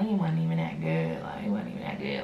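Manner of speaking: low, muttered self-talk; dismissive self-consolation. Retold or imitated. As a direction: play it down to yourself in a low voice. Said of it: "I tried to make myself think".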